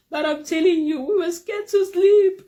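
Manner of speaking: high, loud laughing through the words; laughing hard. Real, not acted. Telling listeners about the fright, laughing hard. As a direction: crack up as you say it.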